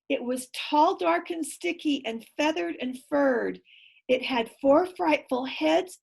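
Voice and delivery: plain reading voice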